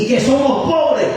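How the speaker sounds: imitating someone pleading poverty; mock excuse. Acting out an excuse not to serve.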